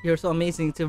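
ordinary talk, nothing marked

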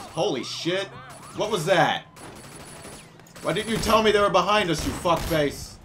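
yelling in the middle of a car chase. Real, not acted.

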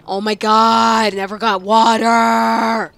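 loud, slow, drawn-out wail; bellowed complaint, held long; exasperated holler. Real, not acted. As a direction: stretch every word into a long yell. Venting about the hard ghost.

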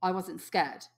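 ordinary delivery

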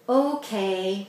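ordinary delivery

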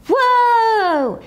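high breathless whispered whoa; awed kid whoa; amazed whoa. Acted. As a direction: gasp in wonder. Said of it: "said my friend named Lenny".

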